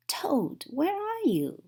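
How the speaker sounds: high call into the dark. A narrator's reading. Frog calling for Toad in the dark house, high call. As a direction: call out searching a dark room. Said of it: "called Frog".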